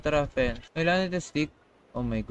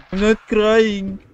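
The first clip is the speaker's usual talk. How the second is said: higher, long drawn-out mock wail; mock crying; 4 semitones higher, 4 dB louder, much slower than usual. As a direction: playfully whine it out, stretching the words. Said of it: "Why you cry again?". (kunwaring umiiyak)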